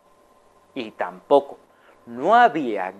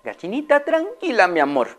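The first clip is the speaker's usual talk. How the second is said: high, calming then firmer; high voice soothing a hen, then a mild telling-off; soothing then scolding lady; 5 semitones higher than usual. Acted. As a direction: settle a tantrum gently. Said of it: "la señora Amelia le dijo".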